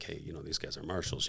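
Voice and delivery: low, very soft tip-off; retold confidential heads-up, kept low and quiet; hushed warning. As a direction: murmur it discreetly to your seatmate. Quietly tipping off seatmate beside marshals.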